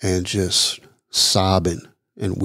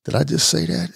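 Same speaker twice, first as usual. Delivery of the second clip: mildly startled, higher self-question; surprised at himself; 9 semitones higher than usual. Retold or imitated. Man surprised by his own words at dawn, a touch higher. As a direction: ask it higher, catching yourself off guard. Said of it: "And I'm like".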